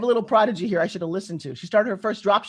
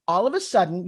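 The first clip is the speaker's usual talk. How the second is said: normal talking voice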